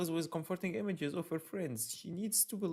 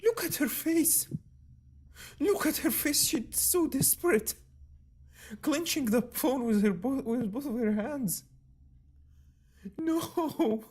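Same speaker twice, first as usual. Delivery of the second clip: anguished outcry; 11 semitones higher, 10 dB louder than usual. Real, not acted. Distressed reading of the scene.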